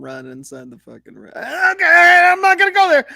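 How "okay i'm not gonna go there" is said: half-shouted, high and loud; loud exclamation. Real, not acted. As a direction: blurt it out loud. Laughing outburst after rant.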